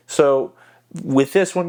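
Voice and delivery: ordinary talk, nothing marked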